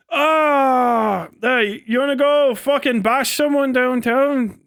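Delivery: put-on Irish accent. Irish mate proposing a fight downtown, in a mock Irish accent. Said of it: "think I'm doing a Scottish accent anyway".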